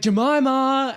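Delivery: high, drawn-out call down a corridor; singled-out name, stretched high. Retold or imitated. Woman calls down the corridor.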